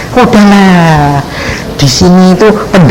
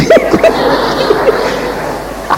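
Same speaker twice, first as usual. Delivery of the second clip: high breathless laugh; 5 dB quieter than usual. Real, not acted. Laughing at the book of Numbers.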